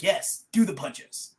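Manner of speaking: slow, gleeful egging on; imitating the god. God Odin urging the fight, slow and gleeful. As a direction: drag it out like a war god cheering. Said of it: "He'd be like".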